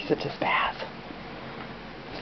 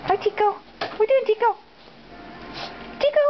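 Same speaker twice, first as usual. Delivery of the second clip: high pet talk; 11 semitones higher, faster than usual. Real, not acted. Owner greeting her dog Tico, high baby talk. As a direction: coo at the dog, pitched up.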